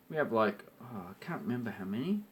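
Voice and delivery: ordinary talk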